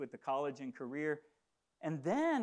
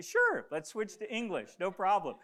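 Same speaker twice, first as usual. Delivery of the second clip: higher, louder, breezy mock reply; breezy agreement; 5 semitones higher, 6 dB louder than usual. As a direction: toss off an easy imagined yes. Imagined easy, confident reply to offer.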